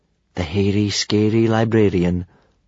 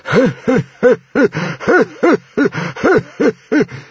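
high, staccato creepy cackle; mocking laugh; 5 dB louder than usual. A narrator's reading. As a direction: titter on and on in mockery. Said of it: "the library itself was laughing at them".

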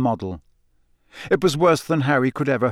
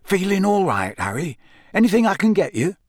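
higher, lightly voiced solicitous official; a touch of fussing concern; Bagman, fatherly; 6 semitones higher than usual. A narrator's reading. Bagman takes Harry aside, lightly fatherly and solicitous. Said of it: "with a fatherly expression on his face".

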